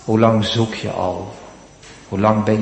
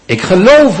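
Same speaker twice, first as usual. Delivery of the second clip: "Ik geloof" cried out high and loud; crying out; 7 semitones higher, 6 dB louder than usual. Acted. The desperate father crying out his faith to Jesus, loud and high. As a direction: call "Ik geloof" out with full conviction. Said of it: "roept die vader uit".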